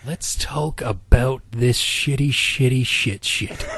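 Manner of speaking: imitating an annoyed critic; mock ranting podcaster. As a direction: drag it out with disgust. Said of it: "the person that wrote this ... be like".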